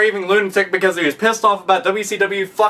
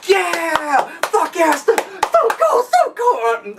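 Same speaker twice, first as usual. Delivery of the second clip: higher, whooping crowd cheer; mock fan cheering, higher pitched; 10 semitones higher, much slower than usual. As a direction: cheer like the whole arena. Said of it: "people will still be like".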